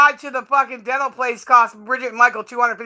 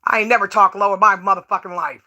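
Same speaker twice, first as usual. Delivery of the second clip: normal talking voice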